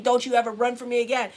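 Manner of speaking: loud imitated parental threat; loud retold scolding; scolding dad. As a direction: imitate an angry father laying down the law. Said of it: "and saying".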